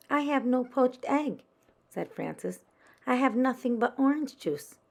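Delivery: put-on a little girl protesting; child's complaint. Frances upset about missing egg.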